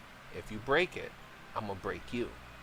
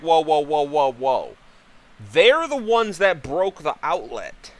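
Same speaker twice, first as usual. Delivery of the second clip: loud, high, defensive outburst; mock frantic protest; 6 semitones higher, 12 dB louder than usual. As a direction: re-enact a dad loudly shifting the blame back. Acts out dad's frantic defence.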